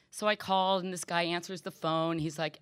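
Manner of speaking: ordinary talk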